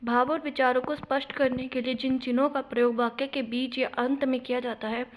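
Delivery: usual speaking voice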